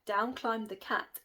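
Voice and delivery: ordinary delivery, nothing marked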